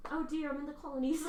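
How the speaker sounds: British accent. Shown as a demonstration. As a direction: warm up a put-on British accent. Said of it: "how do you get in your British accent?".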